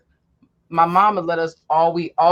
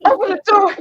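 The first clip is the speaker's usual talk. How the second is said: higher, louder panicked call; desperate shout; 11 semitones higher, 7 dB louder than usual. Retold or imitated. Kid dragged beside the car yelling to mom, high and loud. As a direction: yell for mom to open up.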